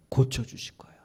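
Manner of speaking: whispered "고쳐주실 거야"; whispered plea of the broken saint. Acted. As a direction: breathe it out, a dying hope. Repeated, whispered.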